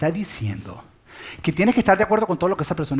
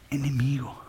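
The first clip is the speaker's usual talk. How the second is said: dramatic whisper; 3 semitones lower, 7 dB quieter, slower than usual. Acted. Preacher to the congregation about the worst enemy, whispered. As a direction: whisper enemigo, unvoiced and soft. Sermon climax on loving enemies.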